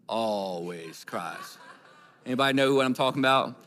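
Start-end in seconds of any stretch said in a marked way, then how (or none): 0.1-1.6 low, soft, very slow delivery; drawn-out punchline. Real, not acted. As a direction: stretch it out slowly, dropping low. Punchline about the crier.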